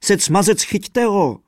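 high, loud hollering; yelling. A narrator's reading. The fiddler yells at his companions to catch it, high and loud. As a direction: holler to the others to grab it. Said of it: "hulákal houslista".

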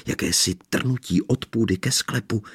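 usual speaking voice

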